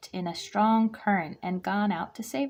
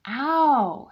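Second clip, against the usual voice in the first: sharp high yelp of pain; 6 semitones higher, 7 dB louder than usual. A narrator's reading. Aluki yelping at scalding soup, sharp cry. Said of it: "she cried".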